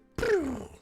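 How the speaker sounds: imitated magic beam noise; a high, quick zap made with the mouth; high "pew" spell noise. Shown as a demonstration. Game master sounds the spell leaving the staff, imitated zap.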